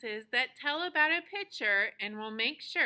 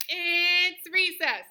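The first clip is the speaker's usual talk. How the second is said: loud, high, drawn-out cheer; exclamation mark in the voice; super excited exclamation; 5 semitones higher, 7 dB louder, much slower than usual. Shown as a demonstration. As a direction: announce it big, stretching the words out. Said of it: "you're super excited".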